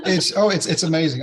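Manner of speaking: laughing. Real, not acted. Overwhelmed guest laughing mid-story.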